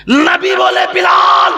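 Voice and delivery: high, loud sermon cry; fiery preaching. Acted. Preacher calling Bilal's name at full force. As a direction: preach it out at the top of the voice.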